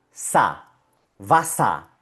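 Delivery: low "sa" alone, then "vasa" joined; syllable then whole word. Shown as a demonstration. Teacher giving the last syllable, clear "sa" then "vasa". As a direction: isolate sa, then model vasa ending on A. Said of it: "Segunda sílaba y última".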